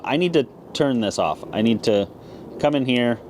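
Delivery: usual speaking voice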